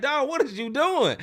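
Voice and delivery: high, loud disbelief; stunned at the question; mock outrage; incredulous friend.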